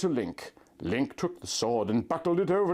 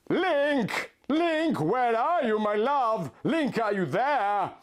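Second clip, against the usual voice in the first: calling out; 8 semitones higher, slower than usual. Acted. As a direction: shout for her lost love into the dark. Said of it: "I cried out into the darkness".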